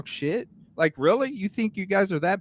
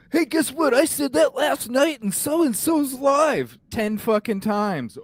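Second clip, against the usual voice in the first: mocking troll voice; 9 semitones higher than usual. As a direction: parody a smug commenter showing off. Mimicking boasting trolls in chat.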